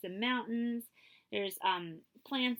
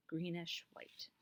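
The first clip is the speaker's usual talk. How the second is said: whispered disappointment; 4 semitones lower, 11 dB quieter, slower than usual. A narrator's reading. Disappointed description.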